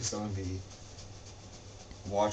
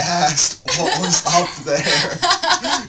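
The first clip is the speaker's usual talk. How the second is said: louder, higher and slow, laughing; indignant protest; 9 semitones higher, 11 dB louder, much slower than usual. Real, not acted. Friend objecting that his story got stolen, loud laughing protest.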